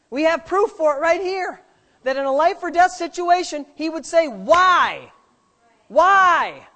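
preaching at full force. Acted. Preacher pressing the congregation, loud full-force preaching. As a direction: press the question home, preaching hard.

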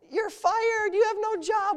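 high, crying with laughter; laughing hard. Retold or imitated. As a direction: gasp it out through tears of laughter. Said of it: "We laughed so hard we cried".

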